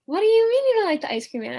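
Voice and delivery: a mother who can't believe what she just heard; incredulous mom. Retold or imitated. Mom reacting to her child disliking the ice cream man, high incredulous.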